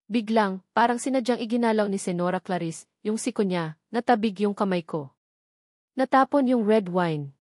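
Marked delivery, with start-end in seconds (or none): none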